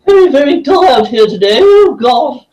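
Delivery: high, quavering elder full of oohs; doddery old person. Acted. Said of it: "one favourite is".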